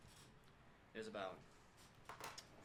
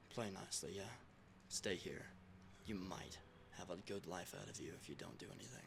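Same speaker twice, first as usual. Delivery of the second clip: low, soft, sneering threats; sneering villain; 5 semitones lower, 11 dB quieter than usual. Acted. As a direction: relish every threat like a cruel boss. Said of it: "Winters being an asshole. Selling the part".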